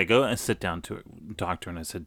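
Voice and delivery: ordinary talk, nothing marked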